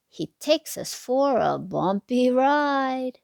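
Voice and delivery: put-on lilting sing-song read to little kids; bouncy storybook rhyme voice; reading to children. A narrator's reading. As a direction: read the rhyme like a bedtime picture book. Rhyming picture book read aloud.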